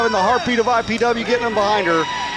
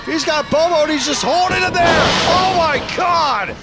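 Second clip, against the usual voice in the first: excited play-by-play; 8 semitones higher than usual. Real, not acted. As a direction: call the pin at full hype. Commentator calls the winning pin.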